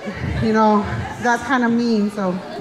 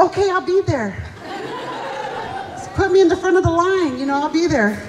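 eager acceptance; 5 semitones higher than usual. Retold or imitated. Eagerly accepting food payment.

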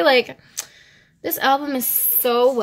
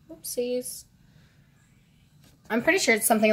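ordinary talk, nothing marked

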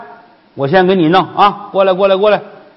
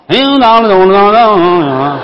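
slow, laughing clang-clang effect; slow 当当当 imitation; magic clanging sound; 3 semitones higher, 4 dB louder, slower than usual. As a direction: tap it out like a spell.

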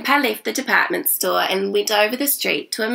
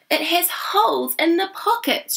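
higher-pitched, mildly exasperated complaint; exasperated grandma; 10 semitones higher than usual. A narrator's reading. Grandma listing the old cardigan's faults, mildly exasperated. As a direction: voice a grandma pointing out faults after a sigh. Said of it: "Grandma sighed".

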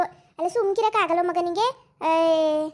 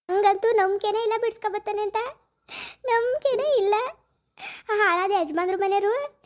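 breaking into sobs; 3 semitones higher than usual. Acted. Daughter sobbing to her mother that she has lost hope. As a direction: let the voice break as the tears come. Breaks down over husband's release.

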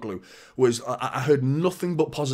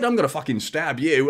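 lively put-on Australian accent; Postecoglou; 2 semitones higher, 4 dB louder than usual. Football pundit imitating Aussie manager, friendly Australian accent. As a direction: make the threat sound harmless in Aussie. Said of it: "if you say it as an Aussie".

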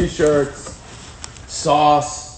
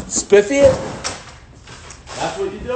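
baffled, pitched way up; incredulous; 5 semitones higher than usual. Real, not acted. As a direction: ask as if it makes no sense. Puzzled question at instructions.

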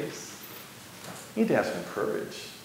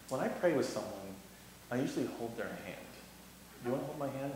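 gentle offer; 11 dB quieter, faster than usual. Retold or imitated.

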